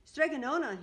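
loud high shout of a name. Acted. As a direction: call her name out eagerly. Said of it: "he shouted".

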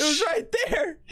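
high wailing whine; half-laughing high wail; mock crying. Real, not acted. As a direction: whimper it like a tantrum. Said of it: "I'm crying".

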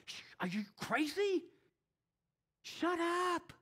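high, urgent mock hiss of soldiers; high pitched mock warning to shut up; hushing soldiers. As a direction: hush him like a panicked soldier. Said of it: "they're like".

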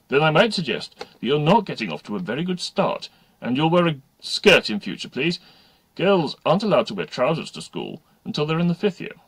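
loud, disapproving schoolteacher; stern teacher. Acted. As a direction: tell off a new pupil formally. Stern games teacher rebukes new pupils.

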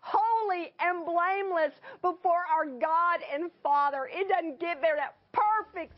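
high-pitched, fervent sermon climax; fervent preacher driving the message home; impassioned preaching. Real, not acted. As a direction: proclaim it with rising emotional force. Preaches climax at full force.

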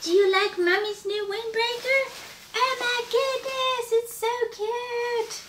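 high, cooing mommy voice. Real, not acted. Shows windbreaker to her child.

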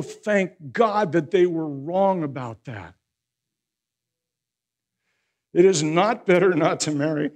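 loud, high-pitched sermon delivery; impassioned preaching. Real, not acted. Pastor urging the congregation that broken marriages can heal, impassioned preaching. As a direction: preach it with force, driving each point home.

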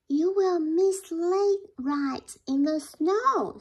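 high, slow little mouse voice; eager mouse. Acted. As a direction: coax the bear as the mouse. Mouse waving his arm.

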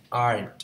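"Aren't" as one firm syllable, "arnt"; "Aren't" with r running straight into nt; corrected pronunciation. Shown as a demonstration. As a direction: punch out "Aren't" in a single loud beat. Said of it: "sửa lại cách đọc là aren't".

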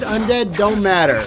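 ordinary talk

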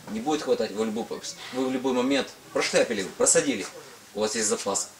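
ordinary talk

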